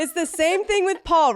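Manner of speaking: high, laughing through the words. Real, not acted. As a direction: laugh as you make the comparison. Laughs while comparing to Paul.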